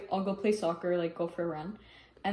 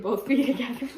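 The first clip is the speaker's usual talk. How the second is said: laugh breaking through; 3 semitones higher, 3 dB louder than usual. Real, not acted.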